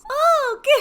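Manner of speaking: high, loud squeal of surprise; little girl gasp. Acted. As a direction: gasp like a thrilled small child. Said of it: "Voy a poner voz de niña pequeña".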